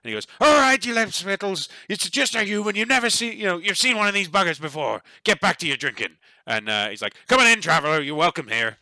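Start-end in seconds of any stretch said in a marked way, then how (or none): 0.4-6.2 loud, high-pitched burly dwarf bellow; boisterous dwarf shouting down his regulars; burly dwarf barkeep. Acted. 7.3-8.8 loud, hearty dwarf welcome; boisterous dwarf innkeeper, raised pitch and volume; welcoming dwarf barkeep. Acted.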